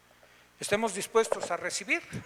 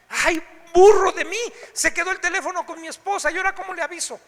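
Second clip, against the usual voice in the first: loud, high mock self-scolding husband; fretful self-scolding; 7 semitones higher, 10 dB louder than usual. Husband stranded without phone, scolding himself, loud and fretful. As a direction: scold yourself for forgetting the phone.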